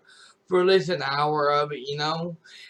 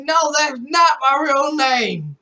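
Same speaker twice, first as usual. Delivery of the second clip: higher, louder, slower insistent shout; retold denial; 8 semitones higher, 5 dB louder than usual. Streamer denies his real name to chat, insistent shout. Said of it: "I'm like".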